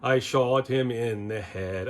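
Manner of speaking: slow, drawn-out mock Scottish crooning; thick Scottish accent. Host imitating a goofy song lyric, mock Scottish accent. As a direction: stretch it out in a bad Scottish singing voice. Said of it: "with a thick Scottish accent singing".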